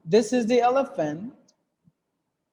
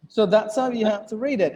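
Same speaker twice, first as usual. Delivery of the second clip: ordinary delivery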